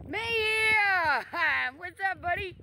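light up at seeing the dog; excited greeting. Real, not acted. Owner spots his dog and calls out, thrilled.

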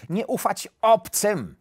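frustrated, firm repetition; annoyed wolf. A narrator's reading. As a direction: insist on "obcym", losing patience. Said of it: "denerwował się wilk".

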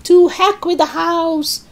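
high-pitched, dismissive cry; defiant shout. A narrator's reading. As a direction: shout it down, not caring a bit. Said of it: "she shouted".

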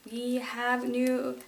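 usual speaking voice

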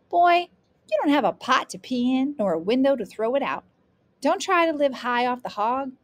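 loud, high-pitched folksy scolding; admonishing grandmother. Acted. Grandmother teaching her grandson with proverbs, loud and admonishing. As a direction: lay down the law as grandmother.

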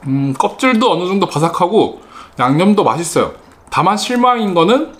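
chewing while talking; muffled chewy speech; mouth full of food. Real, not acted. Seen 3: Bit chicken at -2; cheeks move, lips pursed chewing during words.

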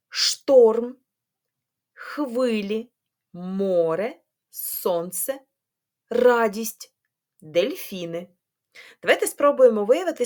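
normal talking voice

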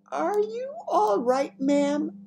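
very high, slow, loud query; anxious question. A narrator's reading. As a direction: check on a shocked mistress. Maid asks shocked Queen, very high.

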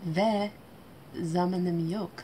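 usual speaking voice

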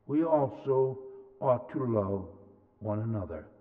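ordinary talk

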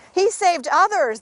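high, loud scoff; crowd's scornful taunt. A narrator's reading. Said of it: "they scoffed".

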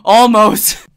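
shouted almost with a high fall; loud exclamation. Real, not acted. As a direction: blurt it out, laughing at yourself. Laughing self-correction.

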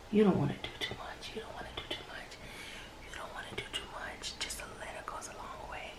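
hushed makeup whisper; soft whispered tutorial aside. Real, not acted. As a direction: breathe the words out, barely voiced. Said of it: "Why am I whispering?".

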